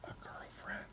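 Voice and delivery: hushed secret whisper. Real, not acted. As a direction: whisper it so the wife won't hear. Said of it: "I have to whisper this".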